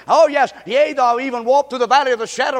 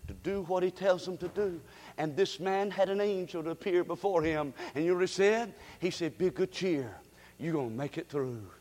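much softer, low hushed telling; soft intimate preaching; hushed sermon; 7 semitones lower, 13 dB quieter than usual. Acted. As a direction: pull them in close, barely above a breath. Drops to hushed storytelling.

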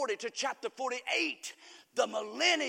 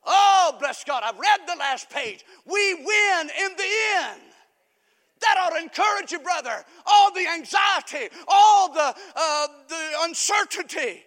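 loud, high, exultant pulpit shout; hard-hit words, drawn out at the peaks; full-force preaching; 6 semitones higher, 11 dB louder, slower than usual. Real, not acted. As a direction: cry out victory to the congregation.

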